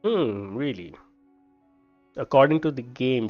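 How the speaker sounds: ordinary delivery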